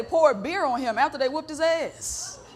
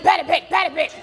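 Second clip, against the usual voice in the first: higher mock call-out; mimicking hailers; 5 semitones higher, 4 dB louder than usual. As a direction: parrot the people who hail you. Mimicking people who hail her.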